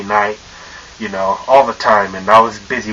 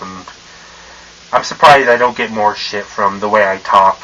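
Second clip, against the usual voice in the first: normal talking voice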